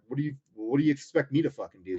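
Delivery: mildly heated, disbelieving argument replay; retold exasperation. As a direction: re-enact an indignant comeback. Retelling his angry argument with staffer.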